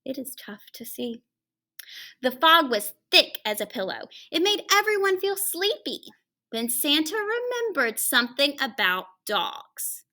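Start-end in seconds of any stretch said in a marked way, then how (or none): none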